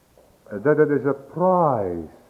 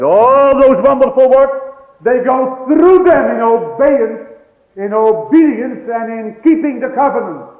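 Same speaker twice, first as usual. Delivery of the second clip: fervent preacher's cry; preaching at full force; 10 semitones higher, 11 dB louder than usual. Acted. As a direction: drive it home from the pulpit.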